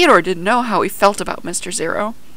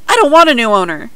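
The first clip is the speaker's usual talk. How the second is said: loud, high, rushed protest; storybook wail of protest, fast and loud; upset cry; 4 semitones higher, 10 dB louder, faster than usual. A narrator's reading. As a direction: cry it out in a hurry. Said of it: "he cried".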